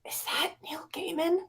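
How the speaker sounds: hushed whisper, leaning close; whisper to friend. Retold or imitated. Leaning over to her friend at dinner, whispering. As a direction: whisper so the next table can't hear. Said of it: "I lean over to my friend".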